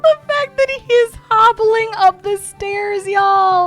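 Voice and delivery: high and loud, slowing into praise; gleeful. Real, not acted. Gamer praising Althea after the fight, high gleeful cheer. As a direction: cheer her sim on.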